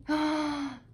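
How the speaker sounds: sharp gasp of delight on opening the melt. Real, not acted. Reviewer smelling her new wax melt, a delighted gasp. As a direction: gasp like you just smelled something wonderful.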